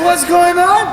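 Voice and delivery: high-pitched, exasperated question; mock a mom demanding an explanation; angry mother. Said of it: "She's so angry".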